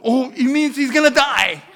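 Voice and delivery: high, loud, laughing triumph; imitating daughter. Daughter guessing the plot aloud, high laughing gloat. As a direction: gloat like a kid who guessed the ending. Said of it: "She's always trying to ruin the movie".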